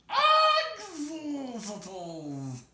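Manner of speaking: high, loud triumphant creature hiss; Gollum hissing. Acted. Gollum to Bilbo, hissing the answer. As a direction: spit it out in glee. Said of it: "He hissed".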